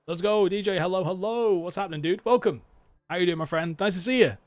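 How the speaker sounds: loud, high, happy hello to a friend; a cheery shout to welcome a viewer; excited greeting. Real, not acted. Greeting chat friend arriving.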